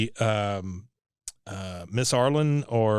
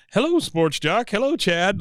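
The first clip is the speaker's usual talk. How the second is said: chummy greeting; 7 semitones higher than usual. Retold or imitated. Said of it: "he goes".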